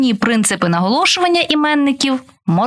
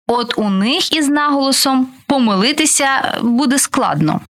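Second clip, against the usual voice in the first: ordinary talk, nothing marked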